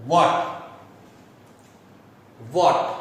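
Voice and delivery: what said like the वाट in किलोवाट, short vowel, crisp t; match what to the वाट of किलोवाट; pronunciation demo. Shown as a demonstration. Teacher showing what sounds like the end of किलोवाट. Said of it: "इसका pronunciation ऐसे करना चाहिए जैसे किलोवाट".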